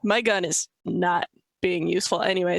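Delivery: normal talking voice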